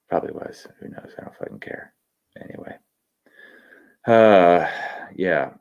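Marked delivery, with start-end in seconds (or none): 0.1-2.8 muttered aside. Real, not acted. Muttering about a chat comment.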